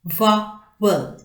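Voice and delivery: "Vowel" broken as vow, el; two separate beats on "Vowel"; split into syllables. Shown as a demonstration. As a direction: sound out "Vowel" with a gap in the middle. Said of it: "two syllables".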